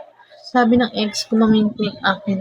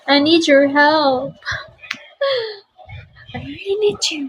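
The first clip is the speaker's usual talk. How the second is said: higher, slow, coy pleading; mock pleading; 6 semitones higher than usual. Streamer coyly begging viewers for three-star gifts. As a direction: beg sweetly, half joking.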